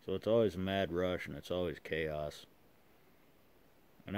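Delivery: words through clenched teeth; talking around a pipe stem. Real, not acted. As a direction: pipe between the lips. Seen 5: Pipe stem held between lips from -3.0 to 4.0s, then removed.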